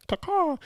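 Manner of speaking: very high bird caw; mock eagle cry. Naming the old truck the Eagle, playful high bird call. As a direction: squawk like a bird in a high pitch.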